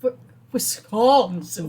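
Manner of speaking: loud, slow mocking vampire "Wisconsin"; Count Dracula. Co-host mocks Dan's pronunciation, exaggerated Count Dracula voice. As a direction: mimic him as a drawn-out vampire. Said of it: "Who are you, Count Dracula?".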